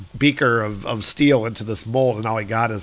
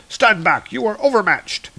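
raised, heroic warning; commanding thunder god; 8 semitones higher than usual. Acted. Thor warning the dark warriors, raised heroic command. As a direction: order them back, pitch climbing.

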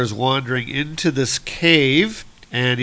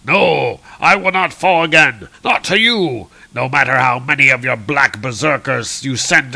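loud, raised heroic defiance; defiant shouting; 2 semitones higher, 4 dB louder than usual. Acted. Old Thor defying the swarming berserkers, defiant shout. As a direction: declaim it, building to a long cry.

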